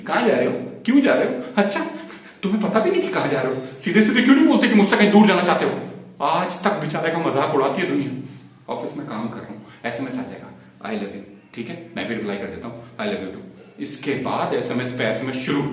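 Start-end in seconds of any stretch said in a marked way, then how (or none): 0.1-5.8 higher, quick-fire mock wife; interrogating wife. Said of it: "नहीं तो दस सवाल पूछती". 8.7-13.5 low, much softer recital; dutiful, resigned. Acted. Recounts dutiful office texting routine.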